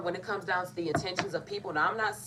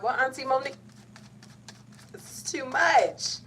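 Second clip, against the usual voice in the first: overwhelmed exclaim; 7 semitones higher, 4 dB louder than usual. Real, not acted. Reader pleading to Auntie Monique, high and overwhelmed.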